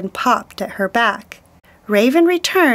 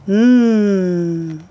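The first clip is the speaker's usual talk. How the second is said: satisfied hum; 4 semitones lower than usual. Acted. Raven eating seal grease.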